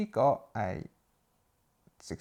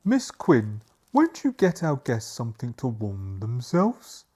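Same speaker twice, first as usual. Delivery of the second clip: lightly voiced butler; changed character accent; 8 dB louder, slower than usual. Butler asks Miss Quinn to serve guests, lightly characterised. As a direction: project it politely as the butler. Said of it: "I'll have to change the accent".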